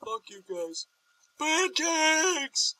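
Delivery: high-pitched put-on voice building to a shout; hammed-up impression of an absent friend; Artie. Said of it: "really try to do his voice right now".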